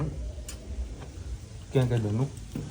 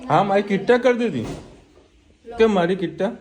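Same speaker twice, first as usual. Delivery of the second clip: high pretend-hurt baby talk; mother playing sulky for her toddler; mock sulk; 8 semitones higher, 4 dB louder than usual. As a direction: pout at the child, pitched well up.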